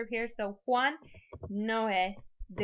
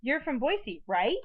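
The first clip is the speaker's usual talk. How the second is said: statement falls, then "right" rises; tag question; 4 semitones higher, 5 dB louder, slower than usual. Shown as a demonstration. Teacher confirms whether it's true, questioning tag. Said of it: "trying to confirm whether or not".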